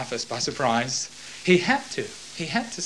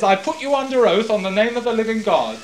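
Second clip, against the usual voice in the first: higher, forceful oath formula; oath command; 7 semitones higher, 4 dB louder than usual. Acted. Caiaphas binding Jesus under oath, raised and forceful. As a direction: bind him by oath, pressing.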